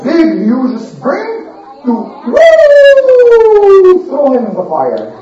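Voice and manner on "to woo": high swooping "woo" of flying through the air; whoosh cry. Retold or imitated. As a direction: sing out the launch like a whoosh. Launching him with a catapult.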